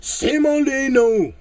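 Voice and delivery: mock soap drama. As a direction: announce the names like a melodrama. Dramatic soap opera names.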